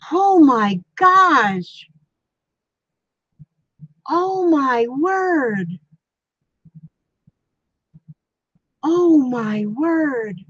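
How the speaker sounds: slow, drawn-out astonishment; stunned amazement. Real, not acted. Streamer reacting to a viewer's donation, stunned. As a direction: stretch each word out, overwhelmed with surprise.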